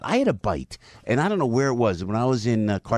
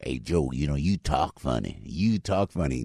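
low, slow Southern drawl; Southern accent; 9 semitones lower, 3 dB quieter than usual. Retold or imitated. Southerners teasing Joe about his speech, Southern accent. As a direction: imitate a slow-talking Southerner, pitched down. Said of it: "I go down south".

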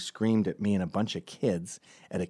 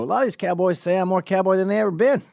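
loud, high-pitched put-on cowboy boast; mock cowboy; 9 semitones higher, 7 dB louder, faster than usual. Fake cowboy boasting in imagined role.

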